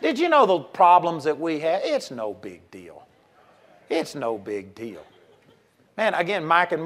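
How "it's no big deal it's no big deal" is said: much lower and softer, slow; soft, slow reassurance. Real, not acted. Preacher gently reassures the congregation, soft and slow. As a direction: let it fall quietly and slowly.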